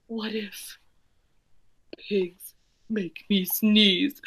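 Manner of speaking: slow scared whisper. Acted. Gerald dreading what pigs do to him, whispered. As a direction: breathe it out in dread.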